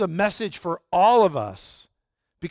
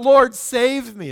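slow, loud, high plea for rescue; crying out; 6 semitones higher, 7 dB louder, slower than usual. Retold or imitated. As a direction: call out like a man sinking in the waves. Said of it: "And he cried out".

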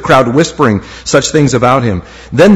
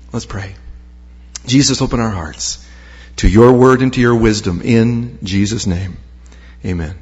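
soft, lower-pitched prayer voice; 5 semitones lower, 4 dB quieter than usual. Real, not acted. Preacher asks Jesus to open hearts, quiet prayer. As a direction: pray quietly and low.

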